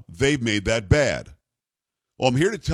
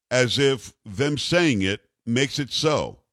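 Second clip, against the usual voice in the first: normal talking voice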